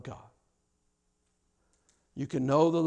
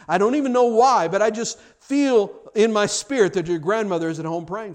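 urgent conviction; 7 semitones higher, 9 dB louder than usual. Acted. Preacher voicing inspired prophetic plea.

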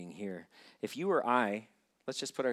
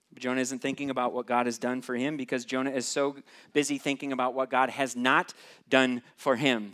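ordinary talk, nothing marked

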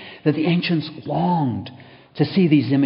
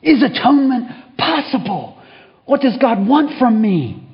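much higher, loud impatient shout; impatient outburst; 13 semitones higher, 7 dB louder than usual. Acted. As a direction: snap at him, losing patience. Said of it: "Growing impatient".

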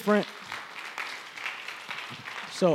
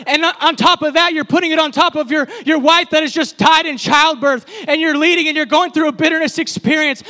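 ordinary delivery

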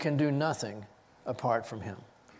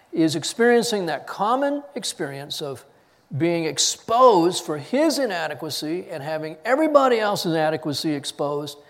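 usual speaking voice

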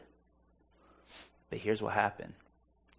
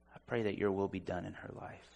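quiet prayer; 3 semitones lower, 7 dB quieter than usual. Retold or imitated. Retold prayer over the woman.

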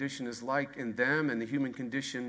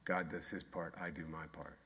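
softer punchline; 9 semitones lower, 12 dB quieter than usual. Shown as a demonstration. Answering own question with slogan.

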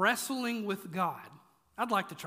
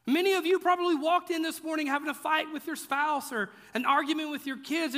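ordinary delivery

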